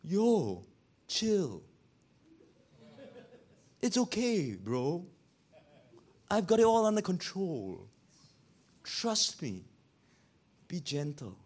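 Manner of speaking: calm them down gently, settling into a hush; laid-back reassurance. Acted. Jesus tells fretting believers to chill, laid-back and soothing. Voices Jesus calming the fretful.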